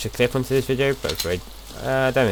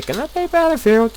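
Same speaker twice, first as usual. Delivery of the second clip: high-pitched girlfriend impression; imitated girlfriend; 4 dB louder than usual. Girlfriend asking to play on a visit, imitated high. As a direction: put on a much higher voice to mimic her asking. Voicing girlfriend's eager request.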